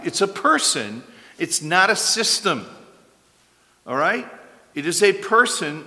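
impassioned pulpit voice; preaching at full force. Acted. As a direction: drive the point home to the congregation. Rising preaching climax.

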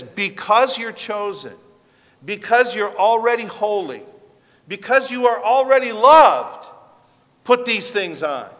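builds from firm to a high, loud cry; rising preached exhortation. Acted. Preacher urging the congregation, swelling to a loud climax. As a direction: climb with each because until it rings out.

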